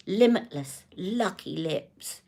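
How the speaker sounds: soft, slow, drawn-out luxury-brand purr; softly stretched, alliterative impression; Charlotte. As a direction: imitate a brand founder savouring each word softly. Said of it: "do it in her accent".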